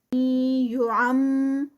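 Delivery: slow, nasal ghunna on یعم; Quranic recitation. Shown as a demonstration. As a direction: hum the م of یعم as you recite. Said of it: "ام پہ غنہ". (غنہ کے ساتھ یعم کی تلاوت)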